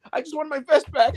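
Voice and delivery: whiny Dom. Retold or imitated. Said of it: "Dom's like".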